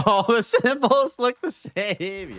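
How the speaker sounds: slow words through a laugh. Real, not acted. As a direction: laugh it out, amused. Laughing at currency mix-up.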